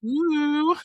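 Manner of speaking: high, slow, prissy impression of a friend; mocking a friend's voice about chocolate sauce and cinnamon. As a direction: mimic her smug Canadian boast, high and drawn out. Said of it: "she's like".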